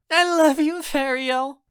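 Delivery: put-on high, loud girlish gush; overjoyed, very high-pitched declaration. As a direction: squeal your affection. Cheering Myra declares love loudly.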